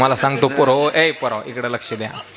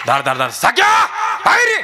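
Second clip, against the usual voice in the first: angry shout at dawn. Retold or imitated. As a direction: pound the door and yell his name. Angry man shouting at door.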